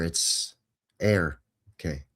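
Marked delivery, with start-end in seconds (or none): 1.0-1.4 "air" said like the word air, the first part of Ayrshire; air sound. Shown as a demonstration. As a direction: show the vowel "air" plainly.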